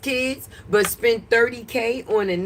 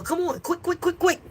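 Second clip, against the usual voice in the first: impatient hurry-up; 7 semitones higher than usual. Acted. Urging chat to type faster.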